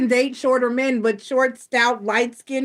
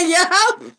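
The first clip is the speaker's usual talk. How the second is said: smug gotcha; 7 semitones higher, 4 dB louder than usual. Retold or imitated. Retold gotcha reply.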